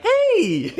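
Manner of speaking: very high, excited greeting; high energy. Shown as a demonstration. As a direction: squeal hello to a little kid. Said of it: "two is high energy".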